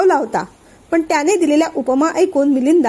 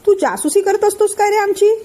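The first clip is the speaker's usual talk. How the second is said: higher-pitched angry accusation; 8 semitones higher than usual. A narrator's reading. Milind accusing Dev of spying, angry high retort. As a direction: snap back, feeling spied on. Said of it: "त्यांनी सुद्धा रागानेच विचारलं".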